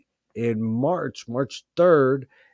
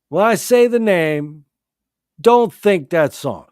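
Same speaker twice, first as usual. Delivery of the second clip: emphatic warning; 5 semitones higher, 8 dB louder, slower than usual. Real, not acted. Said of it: "warning listeners emphatically".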